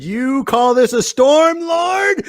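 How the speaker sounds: high, slow defiant shout; Lieutenant Dan. Retold or imitated. Host imitating man cursing God, angry shout. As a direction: rage up at the heavens, drawn out. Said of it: "He had a lot of hatred towards God".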